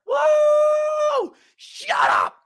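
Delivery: high shouted mock cheer; mocking rival fans. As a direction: imitate the gloating fans. Mocking opposing fans' celebration.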